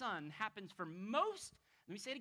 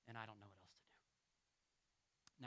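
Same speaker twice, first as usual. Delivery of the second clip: slow, hushed, barely voiced despair; broken whisper; 11 semitones lower, 14 dB quieter, slower than usual. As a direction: whisper it like a boy out of tears. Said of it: "weeping uncontrollably".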